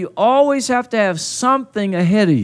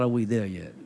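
put-on low, quieter parent echoing the child; imitating a fed-up parent; exasperated parent; 8 semitones lower, 7 dB quieter than usual. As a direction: throw the kid's question back, low and weary. Parent answering the impatient child.

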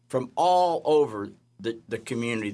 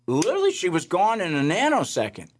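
emphatic amazement; 7 semitones higher than usual. Real, not acted. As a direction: insist it was impossible. Insisting the girl vanished.

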